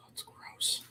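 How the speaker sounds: whispered disgust. Real, not acted. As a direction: breathe it out in disgust. Reacts to trade rumour.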